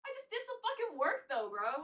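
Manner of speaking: high, loud retold complaint; mock indignant protest. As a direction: protest unpaid work in a high-pitched voice. Acts out protest as unpaid child.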